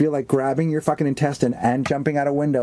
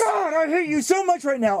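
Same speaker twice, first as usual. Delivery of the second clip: mock hatred; 11 semitones higher than usual. Mock rage at co-host.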